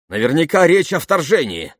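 loud, high-pitched outburst; excited exclamation. A narrator's reading. Soldier guesses the dispatch's meaning, excited exclamation. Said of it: "воскликнул солдат".

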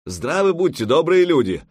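high, loud hail; exclaimed greeting. A narrator's reading. Newcomer hails the armed strangers on shore, exclaiming. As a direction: call out heartily to a crowd. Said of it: "воскликнул он по-русски".

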